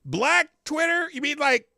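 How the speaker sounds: mock indignant.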